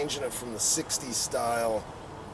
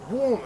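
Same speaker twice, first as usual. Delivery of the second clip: higher mock motor revving; imitated engine rev; 8 semitones higher, 4 dB louder than usual. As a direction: rev it up with your voice like a bike. Imitating his own bike revving.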